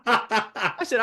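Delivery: usual speaking voice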